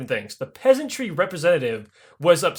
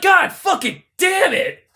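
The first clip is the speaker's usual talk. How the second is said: slow, much higher, louder curse; exasperated shout; 12 semitones higher, 4 dB louder, slower than usual. Retold or imitated. Catherine curses the illiterate nobles, slow high exasperated shout. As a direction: drag out the curse in high frustration.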